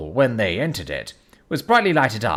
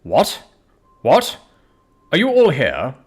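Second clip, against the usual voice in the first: mildly peevish, louder questions; 4 semitones higher, 6 dB louder than usual. A narrator's reading. Lawyer finding all the servants huddled together, mildly peevish. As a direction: put a touch of irritated authority in it. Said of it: "said the lawyer peevishly".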